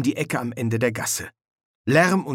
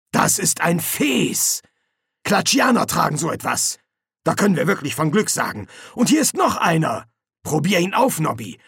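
higher-pitched, pleased sergeant voice; eager sergeant; 5 semitones higher than usual. Acted. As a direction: show off the lucky find to your corporal. Colon finds disguises.

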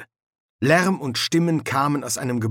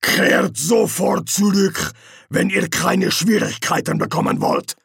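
threatening guard leader; 8 semitones higher, 4 dB louder than usual. Acted.

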